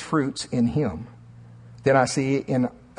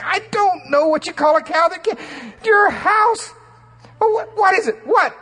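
higher and louder, exasperated cry; frantic yelling; 5 dB louder than usual. Retold or imitated. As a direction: cut the joke short in a panic. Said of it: "That's what I'm wanting to say".

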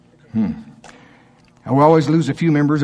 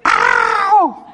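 high, acted yelp of pain; cry of pain; 3 dB louder than usual. Flesh hurting on the cross, acted yelp. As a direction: yelp "ow" far above normal pitch. Acts being hurt on cross.

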